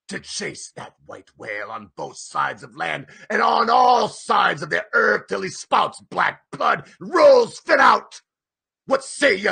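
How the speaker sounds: driving, then swelling loud; rousing captain. A narrator's reading. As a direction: fire up the crew. Ahab rallying the men.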